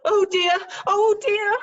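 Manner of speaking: high, loud flustered wail; panicked fluster. Acted. Panic at police.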